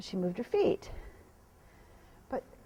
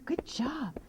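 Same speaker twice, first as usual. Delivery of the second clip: soft breathy good job; whispered praise; 2 semitones higher, 5 dB quieter than usual. Real, not acted. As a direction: murmur it to the horse.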